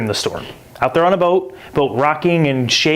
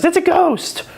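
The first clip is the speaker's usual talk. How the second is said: high frightened cry of a ghost; slower than usual. Retold or imitated. Disciples spotting a figure on the lake, high frightened cry. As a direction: cry it out as scared sailors. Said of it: "it says".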